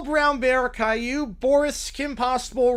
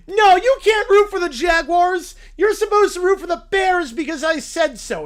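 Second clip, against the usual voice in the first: high, loud scolding; bossy shout; 6 semitones higher, 5 dB louder than usual. Acted. Little Brown Bear ordering a friend to root for the Bears, loud. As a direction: boss them into cheering Bears.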